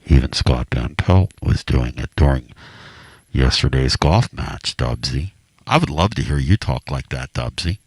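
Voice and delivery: mock the hallowed Masters commentary tone; Augusta golf broadcast voice. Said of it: "talks like this".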